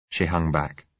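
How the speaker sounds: ordinary delivery, nothing marked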